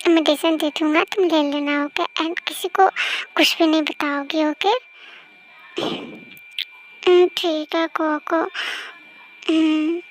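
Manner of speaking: ordinary delivery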